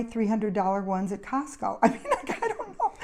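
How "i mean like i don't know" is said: high, giggling through the words; helpless amused giggle, pitch shooting up; real laughter. Real, not acted. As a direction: laugh while backing off your suggestion. Laughing at own Costco suggestion.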